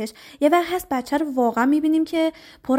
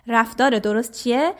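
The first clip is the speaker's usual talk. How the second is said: ordinary delivery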